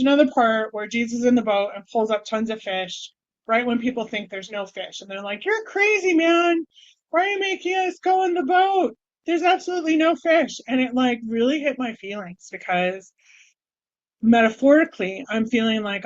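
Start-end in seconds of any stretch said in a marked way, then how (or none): 5.4-10.6 high, mocking shout of disbelief; jeering fishermen; scoffing doubters. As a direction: scoff at him like he's lost it. Doubting fishermen mock Jesus.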